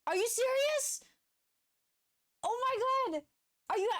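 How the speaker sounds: can't believe it's real; real thrilled cry; excited disbelief. Real, not acted. Confirming the commission.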